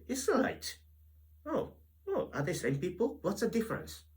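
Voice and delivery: high, hammed-up puzzled reader; mock confusion. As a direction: play the baffled reader, way up high. Acting a confused Bible reader.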